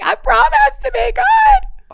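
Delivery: put-on high, loud, drawn-out little-kid wail; hammed-up begging child voice; pleading four-year-old. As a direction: plead like a small child throwing himself down. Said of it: "my little one who's four, like, can negotiate".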